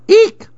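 high startled monkey shriek; monkey eek. A narrator's reading. Monkey shrieks at the unseen lizard, high and loud. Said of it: "went the monkey".

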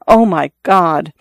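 slow, shuddering gasp of horror; a woman shuddering at the tale of her lover's death; horrified. A narrator's reading. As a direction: let the horror slow the words down. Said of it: "with shudders of horror would occasionally say".

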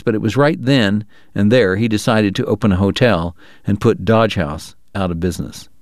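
plain reading voice

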